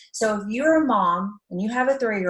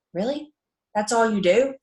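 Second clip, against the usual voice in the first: mock disbelief. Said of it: "they're like".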